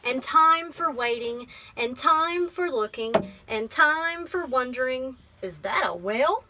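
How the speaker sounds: gentle, measured picture-book reading; teacher reading a poem book aloud to a child; reading to children. Acted. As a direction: read it like story time.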